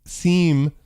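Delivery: seem lightly leaned on; stress on seem. Shown as a demonstration. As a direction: give the word seem a small push. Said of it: "emphasis on the word seem here".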